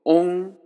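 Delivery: オン held high and loud, an o sent through the nose; オン, one level nasal syllable; nasalised vowel. Shown as a demonstration. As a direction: say オン as o with some nasal sound. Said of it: "少し鼻音も入れると".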